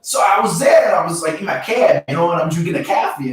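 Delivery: exaggerated New York accent drifting into Boston; put-on Boston-like accent. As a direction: overdo the East Coast cabbie accent. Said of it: "Why are you saying Boston?".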